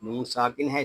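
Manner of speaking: usual speaking voice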